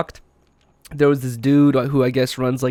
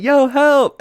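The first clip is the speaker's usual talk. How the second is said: high, loud "Yo, help!"; mock yell for attention; calling out; 11 semitones higher, 5 dB louder than usual. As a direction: shout to people on the cliff. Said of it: "trying to get their attention".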